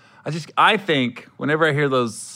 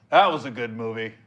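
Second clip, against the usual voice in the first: normal talking voice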